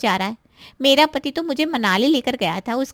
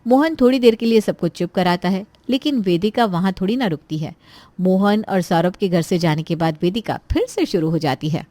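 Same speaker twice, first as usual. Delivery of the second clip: lower, steady storyteller voice; story narration; 5 semitones lower, 3 dB louder than usual. A narrator's reading. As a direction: narrate the scene calmly from outside. Narrator links the scenes.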